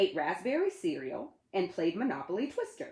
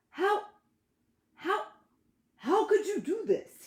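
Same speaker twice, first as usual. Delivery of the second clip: high, stunned repeated how; disbelieving dad staring at a haircut; shocked father; 12 semitones higher than usual. Acted.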